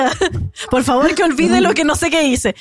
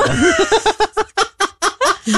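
high cackle; 9 semitones higher than usual. Real, not acted. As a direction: burst of high-pitched laughter. Se ríe de su historia. (carcajada aguda)

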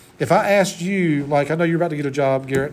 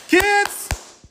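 put-on sharp, much higher shouted "Kids!"; yelling at kids. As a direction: snap the class to attention with one high yell. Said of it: "she was yelling at these kids".

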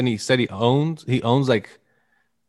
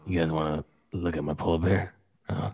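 low, hushed creep voice; creepy dude; 6 semitones lower, 9 dB quieter than usual. Pretend. Creep offering polar bears to passers-by, creepy. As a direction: speak softly and low, like a creep luring people. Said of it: "What, talking creepy?".

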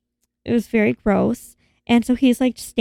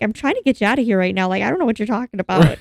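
rushed; much faster than usual. Retold or imitated. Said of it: "And he's like".

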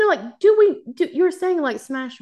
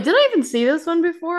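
puzzled; 9 semitones higher, 6 dB louder than usual. Retold or imitated. Own thought at gym, retold.

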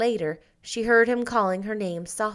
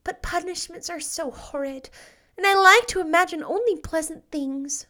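put-on higher, eager girlish voice; Anne; 8 semitones higher than usual. A narrator's reading. Anne pleads with Marilla against punishments, higher girlish voice. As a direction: lift into young Anne, earnest. Anne's voice in read story.

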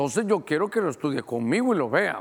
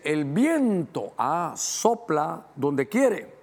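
each word drawn out slowly, with weight; slow the verse right down so it sinks in; slow emphatic reading; much slower than usual. Acted. Pastor reading the verse slowly to his congregation, drawn out.

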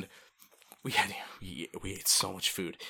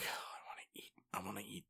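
imitating a girlfriend's hushed craving; breathy little plea, barely voiced; whispered begging; 12 dB quieter, faster than usual. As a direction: whisper it longingly, over and over. Girlfriend begging for sweet potato.

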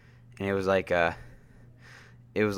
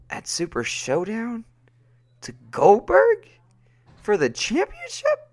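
outraged disbelief climbing into a very high squeak; voice cracking; 7 dB louder than usual. Real, not acted. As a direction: let the voice shoot up and crack on the question. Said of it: "My voice cracked?".

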